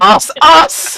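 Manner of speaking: high, put-on captain voice; mock captain shout. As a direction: bark the word like the captain. Mimicking the captain's loud us.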